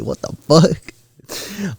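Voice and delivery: slow, high disbelieving what; incredulous. Retold or imitated. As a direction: stretch it out in disbelief. Reacting to friends' insult.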